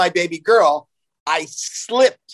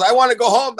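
put-on higher and louder little girl; crying toddler; 7 semitones higher, 5 dB louder than usual. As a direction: whimper it like a naked wet toddler. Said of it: "she goes".

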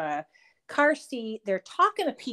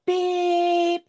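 imitated horn beep; 10 semitones higher, 6 dB louder than usual. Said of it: "And so I went".

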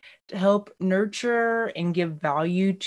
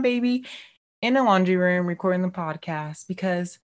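usual speaking voice

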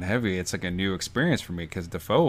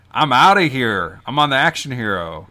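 loud, higher-pitched swaggering exit; triumphant send-off; 5 semitones higher, 9 dB louder than usual. Acted. Leech announces his upgrade to the others, triumphantly. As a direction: crow it as you leave.

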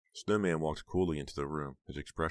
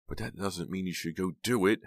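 higher, upset protest; mild cry; 4 semitones higher, 4 dB louder than usual. A narrator's reading. Heather losing control with Snowman, a mildly upset cry. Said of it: "Heather cried".

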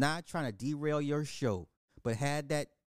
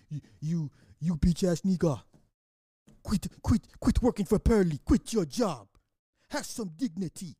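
loud, taunting yell at a man to quit his job; mock harassing yell; 3 semitones higher, 6 dB louder than usual. Retelling street harassment, loud mocking yell. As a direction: bark it like a heckler who won't let up.

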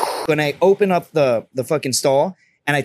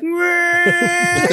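high nasal-free mock whine; 14 semitones higher than usual. Roommate's mocking verdict, high whining meh. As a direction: bleat it out mockingly.

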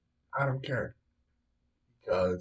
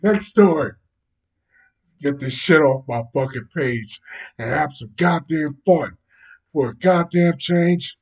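loud, emphatic, pitched up at the start; loud dismissal; 3 semitones higher, 9 dB louder than usual. Real, not acted. Host ordering the next story for some fun, loudly dismissive.

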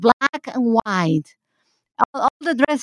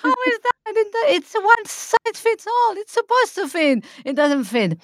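high, exasperated disbelief; incredulous outburst; 12 semitones higher than usual. Retold or imitated. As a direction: squeak out baffled frustration. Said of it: "I'm like".

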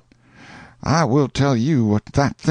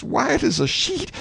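ordinary delivery, nothing marked

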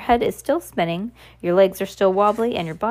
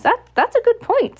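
very high, louder character voice; council elder; 14 semitones higher, 4 dB louder than usual. A narrator's reading. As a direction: pipe up in agreement, pitched way up. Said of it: "said one of them".

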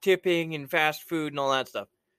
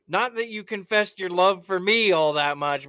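ordinary talk, nothing marked

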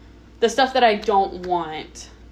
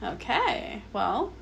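sarcastic; 2 semitones higher, 9 dB quieter than usual. Retold or imitated. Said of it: "And I was like".

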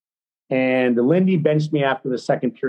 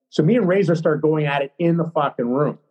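ordinary delivery

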